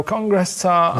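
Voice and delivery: slow, deliberate code word. Retold or imitated. Kidnapped man giving the code word, slowly. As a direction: say it slowly so they catch it. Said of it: "you go".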